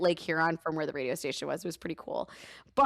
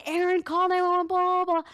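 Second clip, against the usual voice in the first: higher, louder frantic yell for help; retold panicked yell; 12 semitones higher, 5 dB louder than usual. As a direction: call out to husband in panic, voice up. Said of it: "and I'm yelling".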